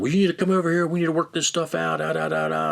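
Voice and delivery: high-pitched, dismissive mimicry of a rant; mock the irate caller's demands; put-on high nagging tirade; imitated ranting.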